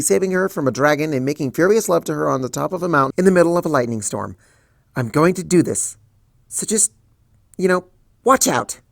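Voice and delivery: psyched up and rambling fast; nervous resolve. Acted. Twilight bracing herself to face Celestia, nervous resolve.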